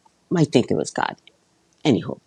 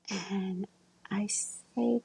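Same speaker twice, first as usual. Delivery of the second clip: hushed whispered lead-in; 3 semitones higher, 11 dB quieter, much slower than usual. Real, not acted. Leaning in to tell her listeners about praying, whispered. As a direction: breathe it out without voice. Whispering the inner prayer part.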